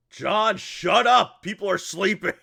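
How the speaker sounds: put-on sleepy dad yelling at his kid; scolding shout. Father yells at his son from the caravan, scolding shout. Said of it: "Guy's voice".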